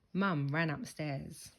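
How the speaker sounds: usual speaking voice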